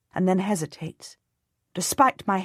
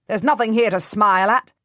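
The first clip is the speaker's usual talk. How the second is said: scornful; 4 semitones higher, 7 dB louder than usual. A narrator's reading. Edward scorns Anthony.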